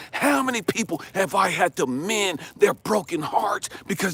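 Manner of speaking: loud, high, hammering tirade; furious rebuke. Retold or imitated. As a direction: berate relentlessly, punching each accusation. God berating Elijah, acted.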